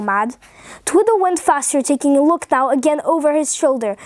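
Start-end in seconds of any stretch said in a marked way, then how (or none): none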